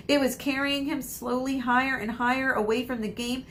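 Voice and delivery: ordinary delivery, nothing marked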